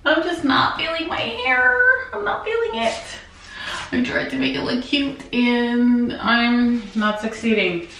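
ordinary talk, nothing marked

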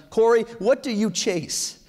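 high, slow, pointed question to oneself; hard self-questioning. Retold or imitated. Speaker putting an ugly question to himself, hard self-questioning. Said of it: "asking myself some really hard, ugly questions".

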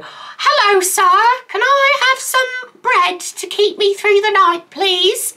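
very high, slow pleading beggar voice; eighteenth-century beggar. Acted. Hungry beggar asking a gentleman for bread, high and pitiful. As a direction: beg like a poor waif from centuries past. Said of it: "In the eighteenth century".

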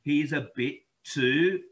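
usual speaking voice

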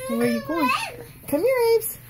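put-on high sing-song coo to the baby; high baby-talk coaxing. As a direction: lure the baby back to you.

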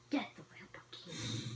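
hushed repeat of the advice; whisper. Real, not acted. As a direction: breathe the tip out under the breath. Repeats tip, then whispers it.

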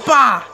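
loud, high burst; sudden "bah" yell. Real, not acted. Player going for a kill, sudden yell. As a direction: blurt a short shout.

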